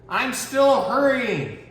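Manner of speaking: high, slow effortful voice; tortoise straining. Acted. Little tortoise to the reader, slow high straining. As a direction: drag it out as if pushing hard.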